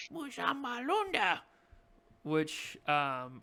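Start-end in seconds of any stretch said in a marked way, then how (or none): 0.1-1.4 higher goblin voice, made-up words; goblin insult. Acted. Goblin answering the cleric rudely, higher pitched. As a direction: spit it out like a rude goblin. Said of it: "And he says".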